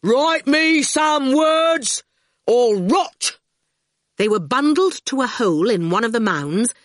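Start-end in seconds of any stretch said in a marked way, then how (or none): 0.0-3.4 slow, loud roaring threat; goon roar. A narrator's reading. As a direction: roar it after them. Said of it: "A great goon roar followed".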